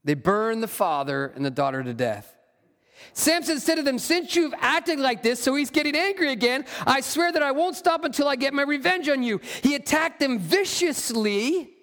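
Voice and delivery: steady, measured Bible reading voice; scripture reading. Acted. Pastor reading Judges aloud to his church, measured scripture reading. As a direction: read the passage aloud to the congregation.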